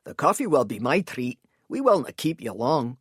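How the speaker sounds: lightly voiced persuasive Scot; courteous Scotsman. A narrator's reading. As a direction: coax him gently. Elias figure voiced.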